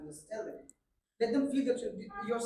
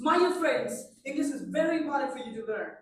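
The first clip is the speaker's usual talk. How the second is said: higher and louder, rousing speaker voice; motivational tone; 3 semitones higher, 9 dB louder than usual. Shown as a demonstration. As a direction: fire up the crowd with energy. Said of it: "We speak it in motivational tone".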